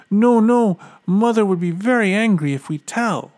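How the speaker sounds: high, pleading little cub; a hint of a frightened child; scared bear cub voice. A narrator's reading. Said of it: "said the cubs".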